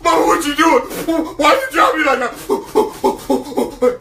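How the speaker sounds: put-on a shaken kid scolding mom; indignant baby eagle. Eaglet asks Mama why she dropped him, slow indignant.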